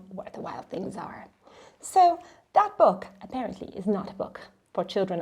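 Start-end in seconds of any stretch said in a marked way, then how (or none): 0.1-1.3 hushed title whisper. Acted. Storyteller whispering the book title to children. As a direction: breathe the title like a secret.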